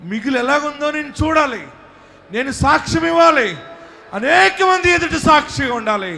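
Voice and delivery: fervent preacher claiming victory; preaching at full force. Acted. Preacher leads prayer at full force.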